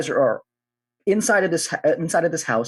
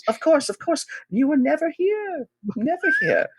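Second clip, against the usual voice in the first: high, slow knowing reassurance. Acted. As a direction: wink as you promise secrecy. GM voices conspiratorial residents.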